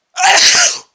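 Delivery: loud explosive sneeze; big sneeze. Real, not acted. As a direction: let a huge sneeze burst out. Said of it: "Oh, what a big sneeze".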